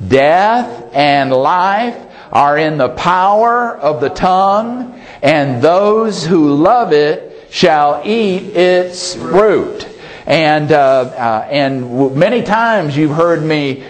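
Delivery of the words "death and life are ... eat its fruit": slow, spaced phrases for others to follow; slower read-along. Shown as a demonstration. Preacher leading the congregation in reading a verse together, slowly. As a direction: lead a group reading, unhurried, pausing between phrases. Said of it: "we're gonna read it a little bit slower".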